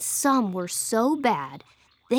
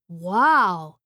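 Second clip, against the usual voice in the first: low, louder dazed wow after a whiff; stunned wow; 3 semitones lower, 6 dB louder than usual. Acted. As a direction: reel from his own smell, stunned. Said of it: "he said with a cross-eyed blink".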